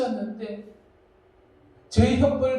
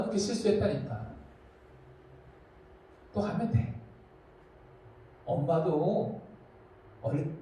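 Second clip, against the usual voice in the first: soft, lower fatherly reassurance; parent encouraging; 6 semitones lower, 5 dB quieter than usual. Retold or imitated. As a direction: gently reassure your child, softer. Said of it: "하면서". (아이를 다독이는 부모의 부드러운 목소리)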